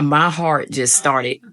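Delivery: ordinary delivery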